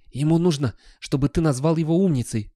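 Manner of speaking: a touch of a sigh. A narrator's reading. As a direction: explain with exasperated patience. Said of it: "вздохнул Гаспод".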